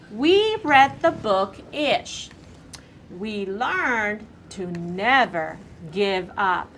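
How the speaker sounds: slow, careful reading back; slow read-aloud. Shown as a demonstration. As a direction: read each word slowly to the class. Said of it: "read you what you said".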